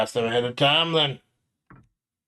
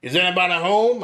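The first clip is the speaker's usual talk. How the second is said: louder call into the house; 8 semitones higher, 6 dB louder than usual. Acted. Player character calls again into the mansion, raised voice. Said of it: "I raised my voice".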